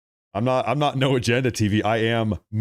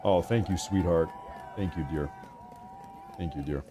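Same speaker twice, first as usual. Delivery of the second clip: soft, low aside thanking his wife; 6 semitones lower, 9 dB quieter than usual. Real, not acted. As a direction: drop the voice to thank her off-mic. Thanking wife off-mic for food.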